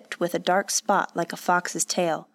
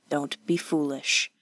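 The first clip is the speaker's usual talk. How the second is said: man's quiet reassurance; 4 semitones lower, 4 dB quieter than usual. A narrator's reading. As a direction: lower voice for the man. Man gently dismisses her apology.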